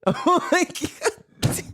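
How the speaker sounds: high squeal through laughter; breaking up, voice shooting up high; laughing. Real, not acted. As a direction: lose it laughing on the words. Cracking up at the retold exchange.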